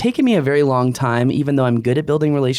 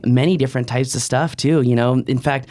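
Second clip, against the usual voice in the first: ordinary delivery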